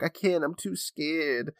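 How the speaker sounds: put-on slow, quiet little-boy scared; I can't, I'm too scared said slowly and softly like a scared little boy; son too scared. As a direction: drag it out like a timid kid. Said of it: "look down the hallway and be like".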